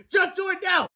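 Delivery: very high urgent yell; high-pitched acted scream; screamed command. A narrator's reading. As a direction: demand it frantically. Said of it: "screamed Fluffy".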